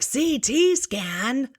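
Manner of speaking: very high, drawn-out mock shriek; alarmed mother. Mother reacts to the rad tech's CT news, mock alarm. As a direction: squeal it slowly in disbelief.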